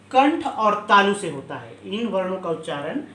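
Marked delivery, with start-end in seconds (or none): none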